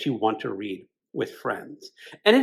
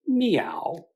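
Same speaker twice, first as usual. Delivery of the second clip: whispered cat meow; 5 semitones higher than usual. Acted. The cat greets Bloom in the kitchen, whispered meow. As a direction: breathe the meow like a sly cat.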